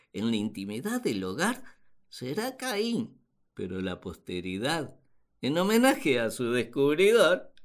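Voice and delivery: ordinary talk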